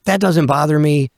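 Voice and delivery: normal talking voice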